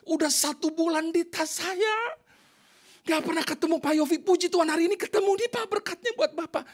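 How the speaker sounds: high-pitched earnest explaining; imitated eager woman. Woman hands pastor a long-kept envelope, high and eager. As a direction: voice her well above your own pitch.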